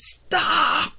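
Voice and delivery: high, loud cry of Stop; a sudden shout at the endless wind; yell. Retold or imitated. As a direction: yell at the storm to end. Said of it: "I yelled it out".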